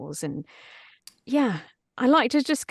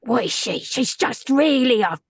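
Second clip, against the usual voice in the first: dark wizard; 2 semitones higher, 5 dB louder than usual. Shown as a demonstration. Asked to demo the dark wizard voice, rough London villain. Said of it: "What's that dark wizard voice?".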